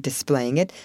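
ordinary delivery

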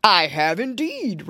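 slow, hearty Santa voice; jolly old saint. A narrator's reading. Santa answering piggy boys, jolly drawn-out reply. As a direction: draw it out with jolly assurance. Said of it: "replied the jolly old saint".

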